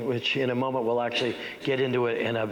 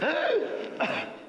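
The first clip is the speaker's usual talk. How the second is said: sharp, high, loud theatrical gasp; loud gasping in-breath. Shown as a demonstration. Teacher demonstrates a gasp for the audience, loud and high. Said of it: "surprise the audience with how loud it is".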